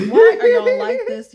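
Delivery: bursts out laughing, high and loud. Real, not acted. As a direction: laugh through the words at them. Laughing at scam victims.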